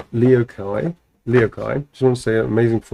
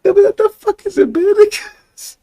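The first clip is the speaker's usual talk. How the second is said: much higher, squeaky incredulous shriek; squealing disbelief; 3 dB louder than usual. Real, not acted. Staring at her own doodle, squeaking in disbelief.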